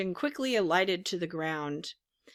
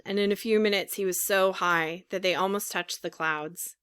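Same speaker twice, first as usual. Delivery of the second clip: usual speaking voice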